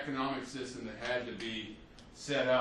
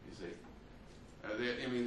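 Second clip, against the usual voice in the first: usual speaking voice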